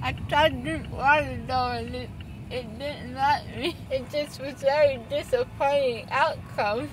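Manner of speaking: numb mouth, drugged and slurry; after wisdom teeth. Real, not acted. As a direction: talk through a numb, swollen mouth.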